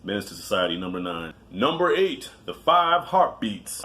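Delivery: usual speaking voice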